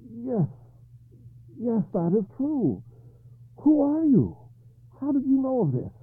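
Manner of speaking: a king caught off guard, stammering in fear; frightened stammer. A narrator's reading. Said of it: "He stammered".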